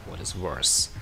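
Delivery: ordinary delivery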